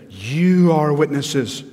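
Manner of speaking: "You" stretched long and loud; slow, emphatic "You"; stress on YOU. Shown as a demonstration. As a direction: lean hard on "You", then fall through "are witnesses". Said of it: "emphatic means emphasize".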